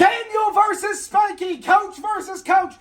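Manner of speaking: high, loud ring-announcer call; announcer hype. Acted. Announcing the coach matchup, hyped announcer call. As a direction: bellow it like a fight card. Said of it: "Commentary, ring the bell, announce the names".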